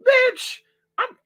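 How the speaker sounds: sudden very high squeal of frustration; exasperated squeal. Real, not acted. Vlogger fed up with silent phone, squealing. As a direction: squeak it out in disbelief.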